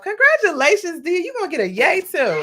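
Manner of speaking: high, loud congratulations; excited cheering. Real, not acted. Coach congratulates viewer on first sponsorship proposal, excited cheering.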